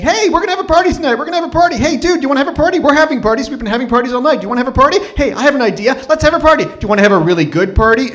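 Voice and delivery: put-on high, loud, fast party-girl voice; pushy party women. Women in the cab pushing a party, shrill high and loud. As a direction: squeal it like a wild drunk partier. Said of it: "they squish me ... and saying".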